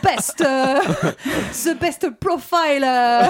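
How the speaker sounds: put-on English with a light Marseille accent, laughing; hammed-up Marseille twang on English, a touch of laughter. Taking up the challenge to voice Elon Musk, Marseille-accented English. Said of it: "Essayez de faire Elon Musk ... avec l'accent marseillais".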